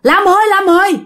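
mild high-pitched cry. A narrator's reading. Dreamer calls out to Lam indoors, mild high cry. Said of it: "bạn la làng ở trong nhà".

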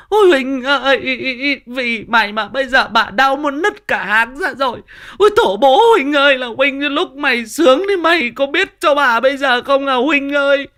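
very loud, high wailing tirade; labour-pain cursing. Acted. Woman in labour blaming husband Huỳnh, screeching wail. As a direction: howl and curse at full pitch, hammed-up. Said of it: "Nó moi cả lò nhà Huỳnh ra nó chửi".

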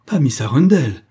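sudden loud, high cry; pained exclamation. A narrator's reading. Daughter interrupting at stepmother's name, anguished cry. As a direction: burst out, almost crying in pain. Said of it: "une exclamation soudaine qui fut presque un cri de douleur".

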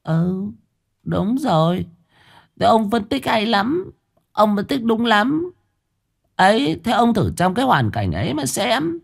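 high, mocking sulk; sulky sarcasm. A narrator's reading. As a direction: needle him with sarcastic praise. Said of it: "ra chiều giận dỗi". (vợ dỗi chồng, giọng cao mỉa mai)

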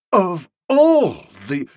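slow, high, loud indignation; sputtering outrage. A narrator's reading. Nobby starts berating Boko, indignant. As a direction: swell on ALL in outrage.

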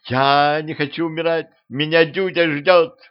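put-on high, whining girl's plea; panicking. A narrator's reading. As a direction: plead in panic, dragging the last words. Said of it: "запаниковала девчонка". (высоко, в панике)

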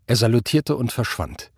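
ordinary delivery, nothing marked

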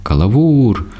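high, drawn-out call for the guard; mild, high-pitched cry; imagined cry for help. A narrator's reading. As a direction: call out high without much extra volume. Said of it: "хотілось голосно крикнути, кричати".

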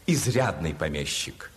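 ordinary talk, nothing marked

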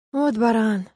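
ordinary delivery

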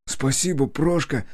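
lightly rasped, high thanks; hoarse. A narrator's reading. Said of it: "хрипло произнёс через минуту журналист".

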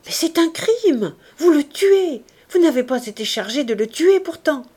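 high, loud, desperate accusation; outraged protest. A narrator's reading. Wife accusing the commissaire of killing her husband, outraged and loud. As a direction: cry out against the men killing him.

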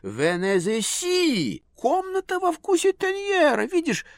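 high, excited, affected lady; gushing society lady. A narrator's reading. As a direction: squeal over everything like a socialite. Lady gushes over paintings.

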